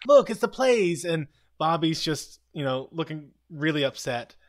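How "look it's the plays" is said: higher and louder mock excitement; a lightly voiced thrilled kid; excited exclamation. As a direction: point and cheer at the find. Mac excited finding the plays.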